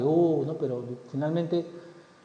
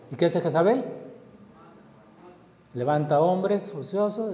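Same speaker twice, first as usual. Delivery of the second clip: usual speaking voice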